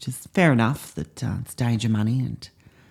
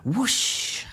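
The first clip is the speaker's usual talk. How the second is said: high breathy whoosh of a flying carpet; mouthed whoosh; 9 semitones higher, 4 dB quieter than usual. Acted. Teacher voicing the carpet flying off, a high whoosh. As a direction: make the carpet whoosh away, higher.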